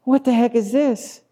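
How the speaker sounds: high-pitched incredulous crowd voice; comically high, baffled complaint; baffled grumblers. Acted. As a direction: squeal it in mock bewilderment. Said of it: "they, and they go".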